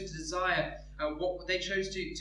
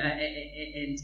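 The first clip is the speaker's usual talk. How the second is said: ordinary talk, nothing marked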